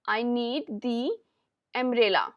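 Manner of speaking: "the" said slowly as "thee"; very slow classroom model sentence; slow teaching demo. Shown as a demonstration. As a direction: draw out "the" as "thee" before umbrella. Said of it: "यहाँ पर हमने the word का use किया".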